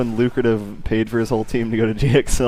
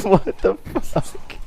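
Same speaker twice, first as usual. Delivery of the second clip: disbelief; 8 semitones higher, 3 dB quieter, slower than usual. Real, not acted. Friend reacting in disbelief.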